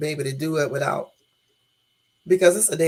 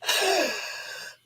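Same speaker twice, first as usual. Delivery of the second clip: giggle; 13 semitones higher, 5 dB quieter than usual. Acted. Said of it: "I'm so crazy".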